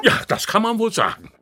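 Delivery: high, loud and fast; agitated burst. Acted. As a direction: blurt it out in a fluster. Santa bursts out.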